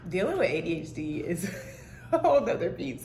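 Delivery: laughing through the words about ADHD; words broken up by a real laugh. Real, not acted. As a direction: laugh while you say it, a little embarrassed.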